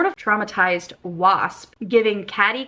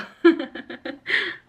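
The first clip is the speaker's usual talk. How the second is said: unvoiced wheezing laugh; breathless laugh; 6 semitones higher than usual. Real, not acted. Laughing at fantasy novel growling, breathless wheeze.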